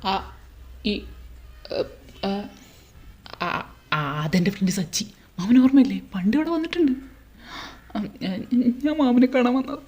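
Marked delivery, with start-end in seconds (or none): none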